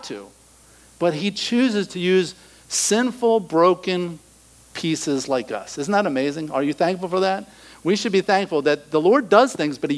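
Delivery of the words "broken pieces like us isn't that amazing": normal talking voice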